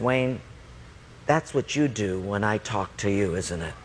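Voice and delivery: low, measured, quiet rebuke; hushed, grave voice from above; God. Retold or imitated. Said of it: "then God spoke to me".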